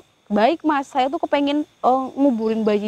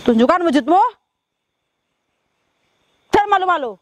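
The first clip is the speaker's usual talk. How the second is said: summoning call; 6 semitones higher, 4 dB louder, faster than usual. Real, not acted. Calls the spirit to show itself.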